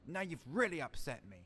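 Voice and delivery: usual speaking voice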